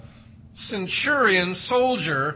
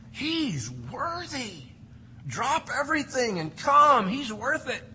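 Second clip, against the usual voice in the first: mock pleading elders, pitched higher; eager elders; 6 semitones higher than usual.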